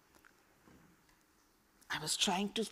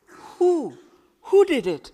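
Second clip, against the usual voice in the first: higher, louder, urgent disbelief; shocked question; 9 semitones higher, 9 dB louder than usual. Acted. Khalid startled by her father's death, urgent shocked question. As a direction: demand to know who did it.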